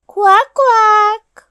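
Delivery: loud, high, drawn-out quacking; storyteller's bright duck call. A narrator's reading.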